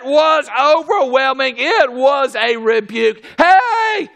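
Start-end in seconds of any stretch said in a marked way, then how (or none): 3.4-4.1 attention grab. Real, not acted. Preacher grabbing congregation's attention.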